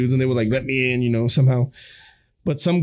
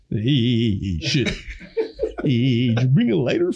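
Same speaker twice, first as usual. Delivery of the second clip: lower, dopey voice asking for a lighter; a slacker buddy lowered a notch; impression of friend Jeremy; 3 semitones lower than usual. He imitates old friend Jeremy asking for a lighter, lower voice. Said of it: "I can do our bases".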